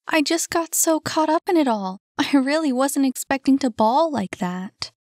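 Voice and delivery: put-on high-pitched girl's dialogue voice; animated, high female character; Saphira, caught up.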